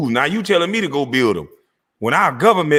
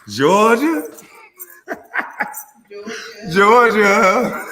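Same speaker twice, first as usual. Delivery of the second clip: mock sing-song calling; 6 semitones higher, much slower than usual. Taunting call of the place name.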